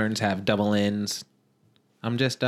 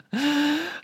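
high-pitched, drawn-out laugh; laughter. Real, not acted. Host cracking up after the co-host's failed bit, high laugh.

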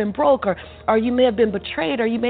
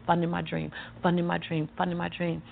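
low, soft chant-like repetition; softly repeated memo; 5 semitones lower, 8 dB quieter than usual. Retold or imitated. Remembering the memo she wrote on every check, soft repetition. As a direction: murmur it like writing a memo line. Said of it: "I kept writing".

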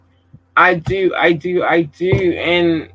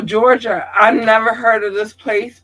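ordinary talk, nothing marked

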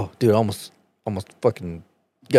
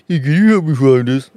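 higher, louder sneering copy of the request; mocking mimicry; 5 semitones higher, 6 dB louder than usual. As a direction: parrot the words back to mock him. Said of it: "Since you like mocking motherfuckers".

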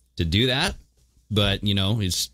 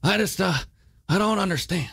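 put-on higher, puzzled old politician voice; Creepy Joe voice; 10 semitones higher than usual. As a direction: play a confused elderly politician, pitched up. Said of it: "like frickin' Creepy Joe".

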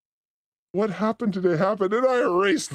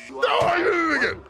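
high, shouted comic wail; exaggerated high-pitched exclamation; mock dismay; 10 semitones higher than usual. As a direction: cry out at your own slip, way up high. Mock dismay at cursing again.

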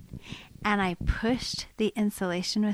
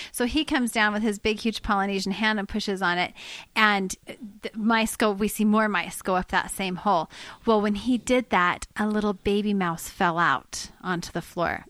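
normal talking voice

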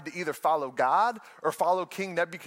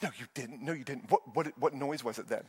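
much softer, doubting retort; skeptical hush; 3 semitones lower, 6 dB quieter than usual. Retold or imitated. Wife rejecting husband's fib in bed, hushed disbelief. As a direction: call his bluff in a low voice. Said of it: "She goes".

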